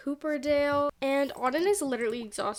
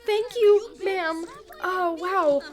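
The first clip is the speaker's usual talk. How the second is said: starstruck thanks; 5 semitones higher, 4 dB louder than usual. Retold or imitated. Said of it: "And she's like".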